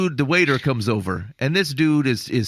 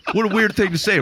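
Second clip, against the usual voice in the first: real amusement; 6 semitones higher than usual. Real, not acted. Friend reacting to the waiter asking for trust, amused. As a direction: say it through a laugh. Cracks up at waiter's odd question.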